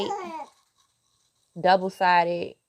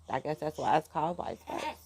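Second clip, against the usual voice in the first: normal talking voice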